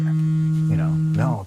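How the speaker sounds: sudden loud high no; mock shouted refusal from the boss. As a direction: bark the no out like a boss. Voicing boss refusing loudly.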